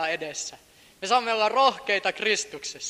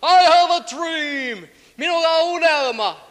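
loud, higher, slow proclamation; shouted quote; 9 semitones higher, 8 dB louder, slower than usual. Retold or imitated. Preacher echoing King's speech to congregation, loud shout. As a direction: call it out over a crowd. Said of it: "se musta mies huutaa".

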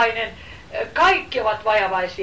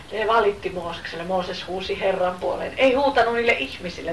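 ordinary delivery

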